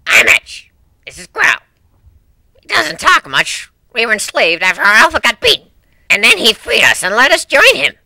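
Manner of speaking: blunt dog soldier reporting; rough diamond dog. Acted. Dog introducing the crew to ponies, loud and gruff.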